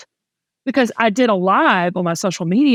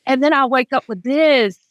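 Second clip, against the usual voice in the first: normal talking voice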